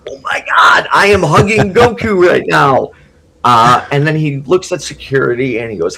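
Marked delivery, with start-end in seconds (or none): 0.1-2.9 starts as an excited whisper and bursts into a laughing shout; hushed gasp building to a loud, higher laughing yell. Retold or imitated. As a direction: let the inner fanboy scream out. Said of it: "And I was like".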